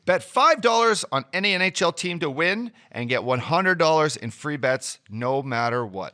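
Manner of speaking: punchy promo read with disclaimer. Acted. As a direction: close the ad hard, rush the fine print. Ad read by host.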